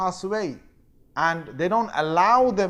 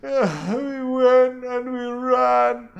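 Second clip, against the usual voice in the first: slow, high, louder hammed-up sobbing; fake crying; 7 semitones higher, 6 dB louder, much slower than usual. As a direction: wail out the lie to their father. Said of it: "came with this whole thing of crying".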